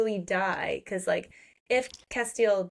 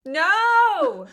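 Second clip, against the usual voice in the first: loud, high, drawn-out no; emphatic protest; 10 semitones higher, 8 dB louder than usual. Real, not acted. Co-host rejects any redemption for the villain, emphatic protest. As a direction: cry out in outraged refusal.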